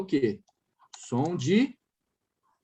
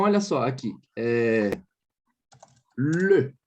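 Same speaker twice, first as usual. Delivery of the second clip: usual speaking voice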